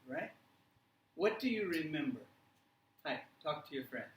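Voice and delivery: ordinary talk, nothing marked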